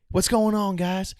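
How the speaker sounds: mock high-pitched Alabama dude; Southern guy. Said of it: "he's from Alabama".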